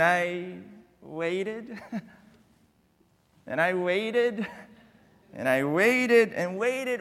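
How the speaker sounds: slow, stretched repeats of waited; drawn-out waiting. Retold or imitated. Pastor stretching the wait for the congregation. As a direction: let the waiting drag on. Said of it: "drawn-out waiting".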